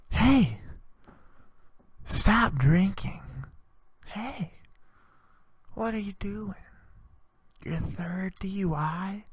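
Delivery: high, soft, slow near-whisper; faint, high-pitched chiding, fading to a whisper; hushed mock scolding. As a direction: gently scold in a thin hushed voice. Acted scolding of drinker.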